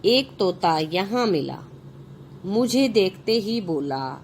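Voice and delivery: put-on slow, clear reading for children; story narration. A narrator's reading. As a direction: read each phrase out slowly to the class. Reads the story aloud. (बच्चों के लिए कहानी पढ़ना)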